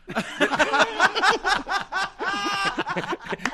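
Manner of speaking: laughs high at his own joke; big, high real laugh; high laughter. Real, not acted. (carcajada aguda)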